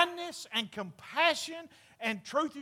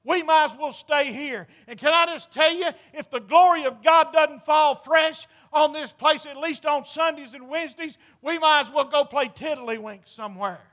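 preaching; 3 semitones higher, 9 dB louder than usual. Acted. Preaching at full force.

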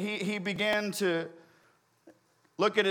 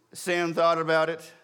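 ordinary delivery

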